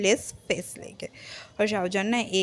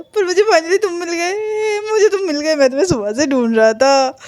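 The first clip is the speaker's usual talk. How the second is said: high, loud gush of relief; overjoyed; 6 semitones higher, 6 dB louder than usual. Retold or imitated. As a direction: gush at finally finding your friend. Overjoyed at finding his friend. (खुशी से चहकते हुए)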